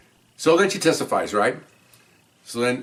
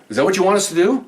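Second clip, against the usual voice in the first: higher, louder incredulous demand; sarcastic challenge; 10 semitones higher, 6 dB louder than usual. Retold or imitated. Officer challenges the judge, incredulous sarcasm. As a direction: throw the question back in disbelief.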